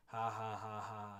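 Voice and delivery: slow, loud jeering "ha ha ha ha"; a courtesan's cruel mocking laugh; mocking laughter. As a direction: laugh at the old fool with contempt. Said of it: "they're laughing at him".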